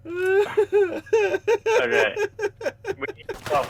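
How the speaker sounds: high, squeaky words through laughter; can barely talk for laughing; laughing helplessly. Real, not acted. Laughing so hard.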